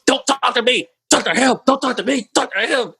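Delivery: high, loud barked orders; mock cop yelling. Imitating the cop snapping at Gabe to talk to the other officer, mock yell. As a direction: snap each order like an angry cop. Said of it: "Why are you yelling at us?".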